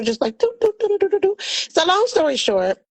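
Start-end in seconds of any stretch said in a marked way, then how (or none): 0.4-1.3 humming casually. Retold or imitated. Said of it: "moving like business as usual".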